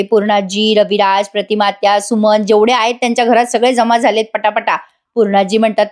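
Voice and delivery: usual speaking voice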